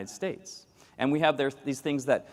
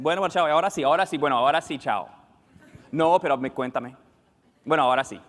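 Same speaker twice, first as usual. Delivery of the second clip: endless goodbyes; 5 dB louder, faster than usual. Retold or imitated. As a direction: keep saying bye but never hang up. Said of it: "the goodbye takes, like, eight times".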